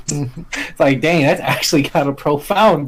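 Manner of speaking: usual speaking voice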